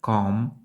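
"comb" ending on M, no final B; silent B. Shown as a demonstration. Teacher shows the hair tool word, silent B. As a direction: say "comb" stopping at the M. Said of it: "теж без букви бі в кінці".

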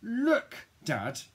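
high, loud wail; angry wail. A narrator's reading. Child too angry with Dad, high loud wail. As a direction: wail it, bursting high on the first word. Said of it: "I wail".

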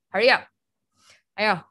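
normal talking voice

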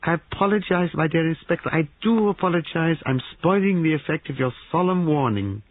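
very high theatrical gentleman; effusive apology. Acted. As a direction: grovel with relish, pitched high. Paravicini effusively apologizes, still amused.

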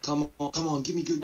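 normal talking voice

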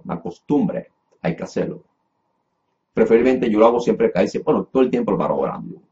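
ordinary talk

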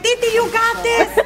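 high-pitched encouragement; eager, high retold cheer; imitated cheering. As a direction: cheer someone on from below. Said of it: "he's in the bottom cheering us up".

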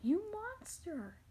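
high, loud, slow outraged cry; angry accusation. Acted. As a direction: hurl it at him in outrage.

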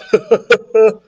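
high chuckle at own joke; acted boyish giggle; goofy laugh. A narrator's reading. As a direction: laugh at your own pun. Michael laughs at his own joke.

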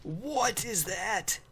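high, drawn-out mock disbelief; hammed-up slow gawp; exaggerated astonishment. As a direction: stretch it out high in playful alarm. Mock alarm at a spotted creature.

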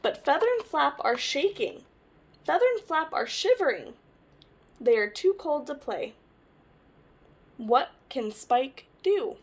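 lively picture-book read-aloud; reading to children. Acted. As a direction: tell it to little ones, brightly.

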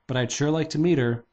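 ordinary delivery

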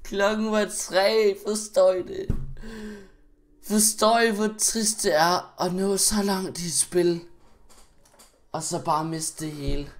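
high, loud, drawn-out wail; loud lament. Real, not acted. Gamer laments lost progress to viewers, loud wailing. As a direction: wail it at the viewers, slowly and loudly.

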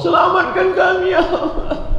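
very high wailing prayer, played for laughs; hammed-up high-pitched cry; mock weeping plea. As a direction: imitate a tearful pilgrim pleading. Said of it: "Lain-lain tu menangis".

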